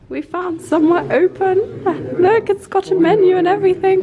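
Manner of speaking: laughing with relief, loud. Real, not acted. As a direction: laugh through the words.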